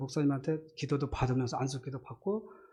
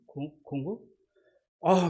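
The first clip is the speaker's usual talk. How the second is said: normal talking voice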